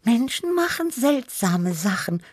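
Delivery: high, musing little cat voice; high-pitched wondering cat; Tibi. A narrator's reading. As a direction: ponder it in a high voice. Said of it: "überlegte Tibi".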